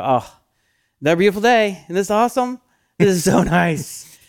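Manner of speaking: over-the-top happy sigh with a laugh; gushing delight. Shown as a demonstration. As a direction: rave about the weather like a kid. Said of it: "I actually go".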